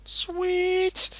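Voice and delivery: high loud excited shout; squealing with glee. Real, not acted. Friend cheering mock excitement.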